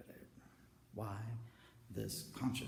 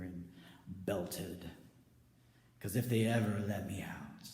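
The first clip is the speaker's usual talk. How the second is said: full-voiced belted blessing; belting proclamation; 8 dB louder than usual. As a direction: proclaim it like a preacher. Belting blessing, holy war.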